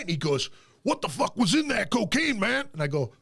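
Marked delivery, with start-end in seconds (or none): 0.8-2.7 high-pitched mock outrage; imitating livid anger. Friend retells Glenn confronting him over cocaine, mock livid. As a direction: act out an indignant complaint. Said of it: "he's fucking livid mad at me".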